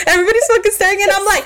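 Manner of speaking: normal talking voice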